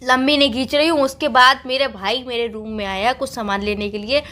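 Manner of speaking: ordinary talk, nothing marked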